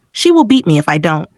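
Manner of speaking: ordinary delivery, nothing marked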